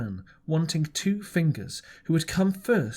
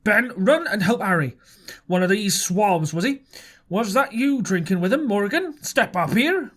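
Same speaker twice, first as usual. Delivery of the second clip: high, louder indignant voice; indignant outcry; 5 semitones higher, 3 dB louder than usual. A narrator's reading. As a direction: fire off the orders, outraged. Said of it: "cried Silver".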